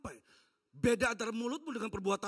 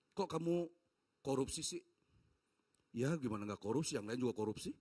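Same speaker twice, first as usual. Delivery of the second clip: low-voiced mock exchange; 8 semitones lower than usual. Mock dialogue about corruption.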